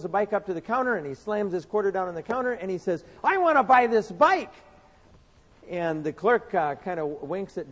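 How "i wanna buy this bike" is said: put-on higher, louder child voice; proud little boy. Johnny to the clerk, higher and louder like a proud little boy. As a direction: announce it like a proud kid slamming down his money. Boy slams quarter on counter.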